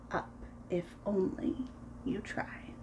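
fading to a soft whisper; whispered ending. Acted. Aunt ends the story for the kids in a fading whisper. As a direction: let the last words die to a hush. Final line fades to whisper.

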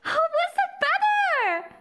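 high-pitched, incredulous exclaiming; astonished. Real, not acted. Amazed that score improved.

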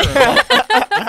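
high squeaky laugh through the words; can barely get it out for laughing; giggling up high. Real, not acted.

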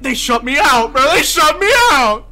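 loud, high outraged shout; outraged yell. Real, not acted. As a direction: yell it at the locked door. Locked out by teammates.

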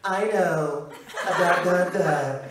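lightly caricatured hushed Trump, in short bursts; Stephen Colbert's Donald Trump. Acted. Comedian mimicking a talk-show host's Trump, mock hush. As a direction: parody Trump with little pauses between repeated words. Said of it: "Stephen Colbert's more hush. He goes".

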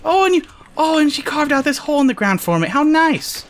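high-pitched gushing delight; mock gushing. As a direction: coo with exaggerated gratitude. Mock gratitude to exploding creeper.

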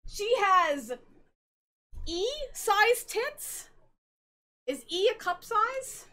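high, slow incredulous question; mock disbelief. Telling friend about Monica, high mock disbelief. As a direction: drag it out in fake shock. Mock-shocked retelling of character.

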